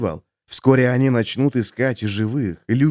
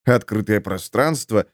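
plain reading voice

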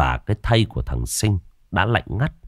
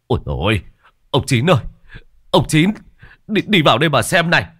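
higher, louder cries for help, slowing on the last call; urgent calling out; 10 semitones higher, 4 dB louder than usual. A narrator's reading. Woman in the back room calls urgently, loud alarmed cries. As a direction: call out in alarm to the old coffin maker. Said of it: "Tiếng gọi mỗi lúc một gấp".

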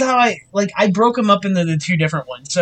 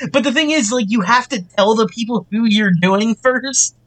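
ordinary talk

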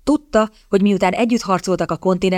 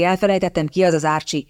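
ordinary delivery

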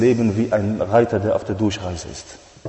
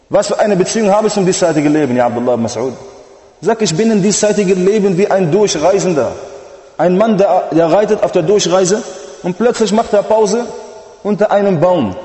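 high, loud tearful plea, slowing on the kings; fervent pleading preaching; 8 semitones higher, 5 dB louder than usual. Acted. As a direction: plead with the Prophet at full force. Prophet's traveller parable.

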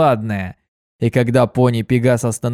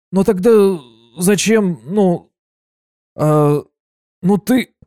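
indignant stammer; 7 semitones higher, slower than usual. Acted. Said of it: "от возмущения заикаться".

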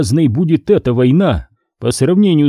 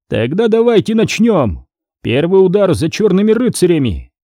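rousing shout; 6 semitones higher than usual. Acted. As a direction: fire up the room. Commissar bangs fist, exclaims.

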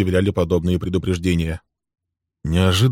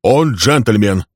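short, slow curt answer; Bill curtly answering his son, curt; 3 semitones higher, 6 dB louder, much slower than usual. A narrator's reading. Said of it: "коротко ответил Билл".

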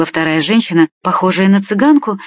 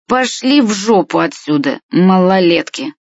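usual speaking voice

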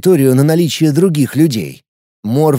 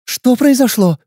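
put-on girl voice; 9 semitones higher than usual.

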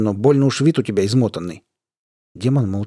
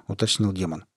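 usual speaking voice

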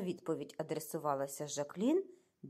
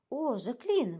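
higher, alarmed call to a friend; frightened exclamation; 6 semitones higher, 4 dB louder, slower than usual. A narrator's reading. Cornelia arrives wide-eyed and calls to Jacqueline, exclaiming in fright. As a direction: cry out the name in alarm. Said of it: "вигукнула вона".

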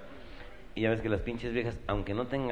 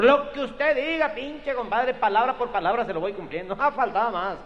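high, loud jovial voice; cheery compadre; 13 semitones higher, 5 dB louder than usual. Acted. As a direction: boom like a happy drinking buddy. Eager compadre.

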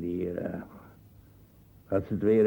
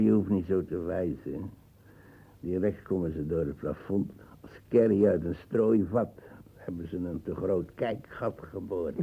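ordinary talk

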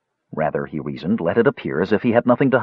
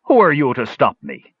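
higher-pitched defiant challenge; belligerent; 6 semitones higher than usual. A narrator's reading. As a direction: square up to someone blocking you. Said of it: "The speaker displayed a belligerence".

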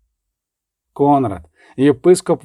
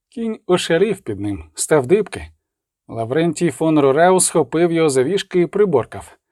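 plain reading voice

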